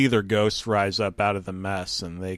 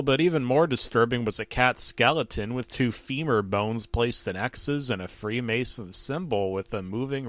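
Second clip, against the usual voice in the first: usual speaking voice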